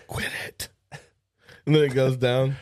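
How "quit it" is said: whispered high and soft. Pretend. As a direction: hiss it under your breath. Scolding hand.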